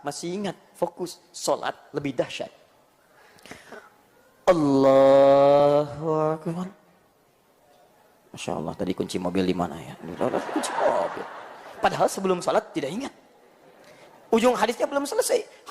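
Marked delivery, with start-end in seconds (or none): 4.5-6.7 long, loud, slow chanted Allahu Akbar; drawn-out takbir. Shown as a demonstration. Preacher demonstrating the opening takbir of prayer, slow loud chant. 8.3-11.2 mock mumbling worshipper. Worshipper mid-prayer remembering the car keys, low murmur.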